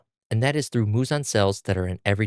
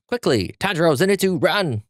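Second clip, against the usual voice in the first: much higher squawking crow cry; crow's warning; 8 semitones higher than usual. Acted. Crow interrupting the boys, high urgent squawk. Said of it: "Tanjiro's crow interrupts".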